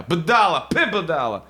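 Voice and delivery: loud, high scornful spit of budala; scornful insult. Retold or imitated. Woman insulting Milan for ten dinar, loud scornful.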